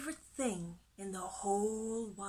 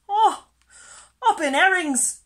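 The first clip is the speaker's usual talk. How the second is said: high, very loud panicked cry; shrieking; 9 semitones higher, 15 dB louder than usual. A narrator's reading. Fish spotting a monster, high frightened shriek. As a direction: shriek it in alarm. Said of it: "shrieked Percy".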